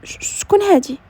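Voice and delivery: taken aback, voice lifting; puzzled. A narrator's reading. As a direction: stumble on the first word in surprise. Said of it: "وسولته باستغراب".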